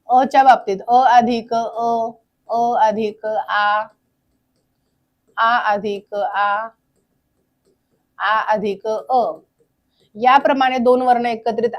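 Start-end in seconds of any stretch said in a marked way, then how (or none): 0.9-9.2 spaced out slowly, vowel by vowel; measured teacher dictation with long pauses. Real, not acted. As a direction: say each term slowly, as if writing it up. Saying sums aloud while writing them.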